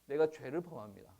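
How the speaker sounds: ordinary delivery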